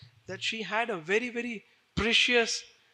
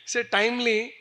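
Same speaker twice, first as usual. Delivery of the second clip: ordinary talk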